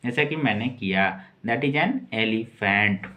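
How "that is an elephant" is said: each word slowly and clearly for the class; slow model reading. Shown as a demonstration. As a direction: read it out slowly like a teacher. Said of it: "इसका pronunciation Hindi में last में करेंगे". (धीमे, साफ़ उच्चारण में)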